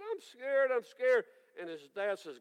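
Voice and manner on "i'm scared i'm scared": put-on high, pleading child's cry; a small boy begging his daddy; scared little boy. Little boy runs to daddy in the storm, high and pleading.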